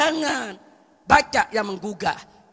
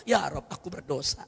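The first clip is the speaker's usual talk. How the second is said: humble confession; 7 semitones lower than usual. Shown as a demonstration. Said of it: "akui di hadapan Allah".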